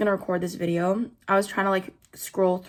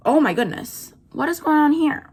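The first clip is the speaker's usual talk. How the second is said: exasperated; 7 semitones higher, 4 dB louder than usual. Real, not acted.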